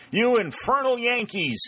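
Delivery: high, loud roar of outrage; an old colonel in bed cries out at the enemy officers in fury; furious cry. A narrator's reading. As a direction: bellow it at the intruders like a caged lion. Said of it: "he cried".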